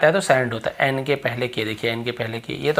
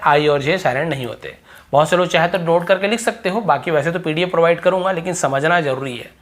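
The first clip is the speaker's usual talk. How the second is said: ordinary delivery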